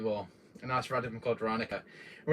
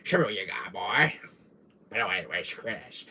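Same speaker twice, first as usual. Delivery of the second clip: lowered, taunting villain voice; Wesker voice; 6 semitones lower, 3 dB quieter than usual. Acted. Streamer taunting chat in his villain voice, low and smug. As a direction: goad the player like a smug villain. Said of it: "I love doing my Wesker voice".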